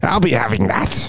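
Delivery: a hint of a sly goblin; lightly voiced greedy goblin. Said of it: "in my goblin voice".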